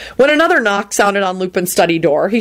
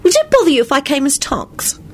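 put-on girlish voice; 9 semitones higher than usual. A narrator's reading. Girl character voiced by narrator.

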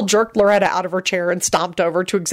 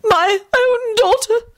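high, slow, tearful stammer; wounded, high little voice; small frightened voice; slower than usual. A narrator's reading. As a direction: falter pitifully, like a scared victim. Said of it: "whispered in a small, frightened voice".

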